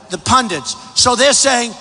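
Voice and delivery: ordinary delivery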